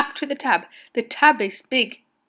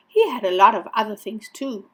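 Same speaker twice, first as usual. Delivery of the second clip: ordinary delivery, nothing marked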